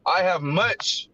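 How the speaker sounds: slow, measured reading of the verse; scripture reading. Acted. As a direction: read the holy words slowly and carefully. Reads scripture aloud.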